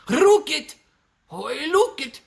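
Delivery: high, rolled-R hammed-up British; mock British accent. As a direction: exaggerate a squeaky British rocket. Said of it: "how you say rocket in Britain".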